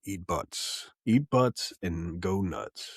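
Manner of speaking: low, slow sign-off; mock podcast voice. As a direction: intone it deep and drawn out.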